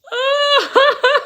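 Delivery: high, loud crying "oh" through laughter; mock crying. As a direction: imitate bursting into happy tears. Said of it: "And I start crying".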